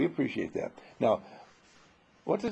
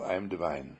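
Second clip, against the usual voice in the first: softer, lower, calm self-affirmation; soft, lowered affirming voice; 7 semitones lower, 5 dB quieter than usual. Shown as a demonstration. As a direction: recite it gently like a quiet prayer. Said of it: "give ourselves just one label".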